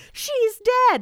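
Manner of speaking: high gasp of disbelief; a high hammed-up wail; mock shock. Retelling Ben seeing the body, in a high mock-shocked voice.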